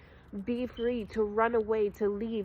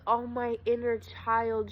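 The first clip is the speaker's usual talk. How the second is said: ordinary delivery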